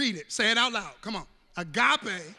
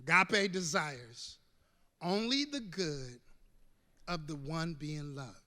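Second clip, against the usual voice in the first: quiet recitation; 5 semitones lower, 7 dB quieter than usual. Shown as a demonstration. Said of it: "Say it with me".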